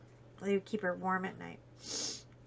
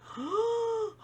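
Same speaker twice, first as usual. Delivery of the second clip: sharp amazed gasp; 13 semitones higher than usual. Real, not acted. Amazed at tiger in car.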